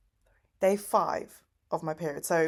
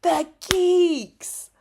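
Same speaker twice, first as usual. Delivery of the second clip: mimicking Tyler; 10 semitones higher, 6 dB louder than usual. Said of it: "He's like".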